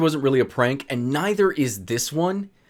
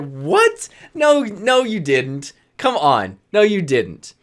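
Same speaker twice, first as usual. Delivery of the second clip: incredulous; 4 semitones higher, 4 dB louder, slower than usual. Retold or imitated. Startled denial of mind reading.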